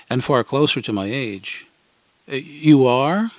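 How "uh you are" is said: slow, louder hesitant reply; stammering. Retold or imitated. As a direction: trip over the words. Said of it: "I stammered".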